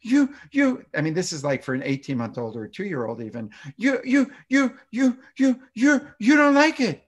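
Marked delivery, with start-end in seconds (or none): 0.0-0.8 put-on much higher, louder, echoing a toddler's upset; toddlerese. Shown as a demonstration. Parent mirroring an upset toddler, high and loud toddlerese. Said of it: "So it might be something like". 3.8-7.0 put-on much higher, louder, echoing a toddler's upset; toddlerese. Shown as a demonstration. Parent mirroring an upset toddler, high and loud toddlerese. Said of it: "mirroring a third of their emotion".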